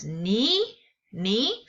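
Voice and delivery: ni rising like a question; teacher showing the rising second tone ni. Shown as a demonstration. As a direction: lift ni upward like asking what. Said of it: "sort of like what".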